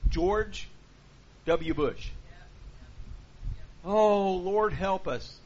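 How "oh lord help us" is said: loud, slow "Lord help us" in mock despair; exasperated groan. Real, not acted. Preacher to congregation, mock despairing loud cry. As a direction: throw up your hands and sigh it out loud.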